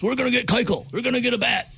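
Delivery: high-pitched mimicry of naive believers; mock hopeful fans. Host mocking people who expect big trades, high mock-hopeful voice. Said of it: "I don't know why people think otherwise".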